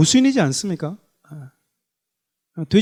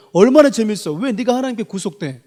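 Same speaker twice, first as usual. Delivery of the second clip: Satan's taunt; 4 semitones higher, 3 dB louder than usual. Retold or imitated. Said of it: "사단은 그래요".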